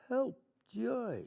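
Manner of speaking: mock distant plea; distant call. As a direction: sound like a voice far off in the dark. Distant enemy calling for help.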